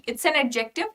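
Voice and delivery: usual speaking voice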